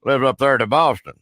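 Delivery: hammed-up backwoods Southern caller; put-on rural Southern accent. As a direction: play a blustering Southern good old boy on the phone. Roy Mercer character voice held.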